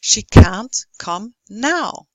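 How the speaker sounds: loud, slow, separate beats on "can't", "come", "now"; slow stressed beats. Shown as a demonstration. Lecturer gives short rhythm example, "can't", "come", "now" slowly stressed. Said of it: "speed up the unstressed ones".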